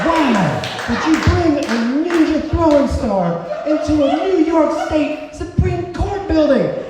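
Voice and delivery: high, put-on voice of a stern guard; incredulous guard. X-ray guard scolds the young man, high and incredulous. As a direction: scold him like a baffled officer. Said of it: "And she said".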